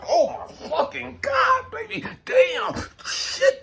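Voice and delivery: slow, high, hammed-up moans of pleasure; acted lover's ecstasy. Imitating a man in bed with his woman, mock ecstasy. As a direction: draw it out like a lover overcome mid-act. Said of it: "she just doing her thing and you're like".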